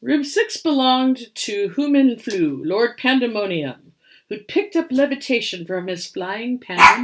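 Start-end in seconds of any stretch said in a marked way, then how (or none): none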